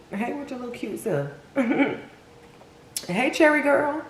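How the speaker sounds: high, cutesy call to a viewer; playful high greeting. Real, not acted. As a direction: coo it out like greeting a cute friend. Cooing shout-out to a viewer.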